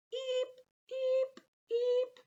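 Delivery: very high imitated truck beep; reversing beeps. Narrator mimics trash trucks backing up with very high beeps.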